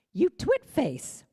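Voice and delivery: high, loud, slow punchline; punchline emphasis. Acted. Speaker finishing a joke quote about social media, loud and slow. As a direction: land the joke big and slow.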